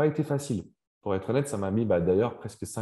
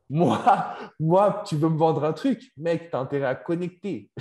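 higher, punchy challenge to a salesman; mock tough customer; 5 semitones higher, 4 dB louder than usual. Playing himself as a tough buyer. (client difficile, ton de défi joué)

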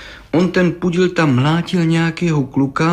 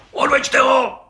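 high-pitched furious yell; roaring; 13 semitones higher, slower than usual. A narrator's reading. Judge orders the brickmaker taken away, roaring. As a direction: bellow the order in a rage. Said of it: "Zařval okresní soudce".

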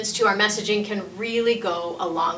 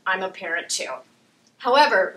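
ordinary talk, nothing marked

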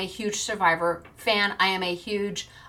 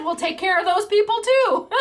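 retold high quote; 10 semitones higher, 5 dB louder than usual. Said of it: "She said".

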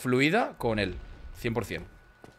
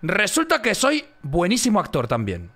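loud, high burst; sudden excited punchline; 5 semitones higher, 4 dB louder than usual. Real, not acted. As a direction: build up the big reveal. Excited storytelling.

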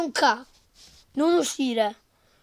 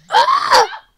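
shrill panicked cry; being chased; 10 semitones higher, 9 dB louder, much slower than usual. Real, not acted. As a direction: cry out in alarm.

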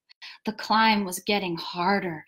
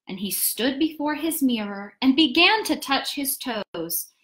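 ordinary delivery, nothing marked

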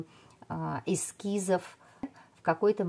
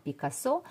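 Пикассо stressed on the final syllable, pee-kah-SO; Пикассо said the French way, end-stressed with a rise; French-style final stress. Shown as a demonstration. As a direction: land on the last -СО of Пикассо. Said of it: "на французский манер с ударением на последний слог".

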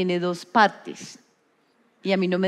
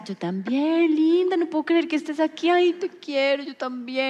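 1 makeup artist gushing; 7 semitones higher than usual. Retold or imitated. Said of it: "Y ella me decía".

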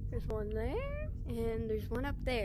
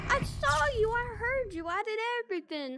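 high frantic shouts; panicked shouting; 11 semitones higher, 6 dB louder than usual. Real, not acted. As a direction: yell in a total panic. Panicked at the animatronic.

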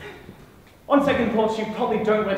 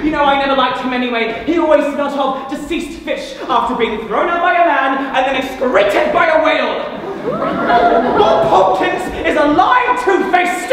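loud, raised-pitch tirade; bitter rant; 5 semitones higher, 6 dB louder than usual. Acted. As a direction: build to an outraged denunciation. Jealous rant about the ex-lover Bob.

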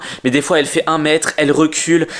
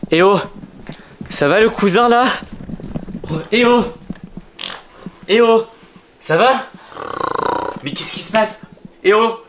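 scared calling out; 6 semitones higher than usual. Acted. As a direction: call out, scared, for the cousin. Calling to transformed cousin.

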